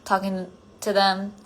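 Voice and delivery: ordinary talk, nothing marked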